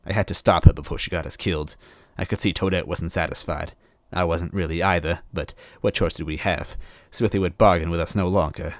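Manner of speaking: ordinary delivery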